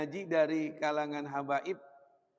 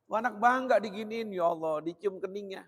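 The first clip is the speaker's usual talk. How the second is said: ordinary talk, nothing marked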